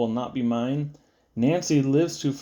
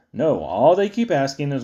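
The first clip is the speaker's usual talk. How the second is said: plain reading voice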